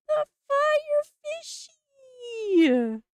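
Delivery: high, slow, voice cracking with joy; nearly crying with delight; overwhelmed stretched cry; tearful excitement. Real, not acted. Near-crying over the card.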